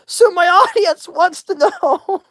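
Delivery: high, giggling through every line; laughing so hard the sides hurt; helpless laughter. Real, not acted. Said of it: "my face and my side hurts".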